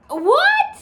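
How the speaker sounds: high, loud, rising what; shocked what. Retold or imitated. Hearing the nurse went home, a shocked high what. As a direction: yelp it in disbelief.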